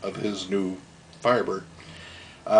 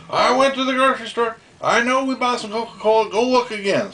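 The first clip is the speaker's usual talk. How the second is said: insistent dad; 11 semitones higher than usual. Retold or imitated. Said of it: "My dad's like".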